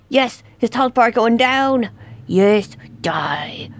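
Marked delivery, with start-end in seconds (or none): 3.0-3.7 soft breathy whisper, no voice; menacing whisper at the monster; whispered threat. Acted. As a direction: hiss the word like a curse. Gloating as enemy health drops.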